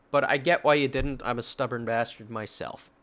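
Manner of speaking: usual speaking voice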